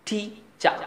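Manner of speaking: টি চা in two separate parts, ending "chah"; British silent r. Shown as a demonstration. Teacher giving English for teacher, টি চা without r. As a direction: say টি চা with no final r. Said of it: "ঠিক ওরকম শিক্ষক ইংরেজি".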